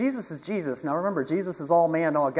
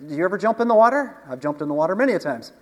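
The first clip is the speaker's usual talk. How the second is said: ordinary delivery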